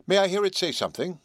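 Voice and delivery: ordinary delivery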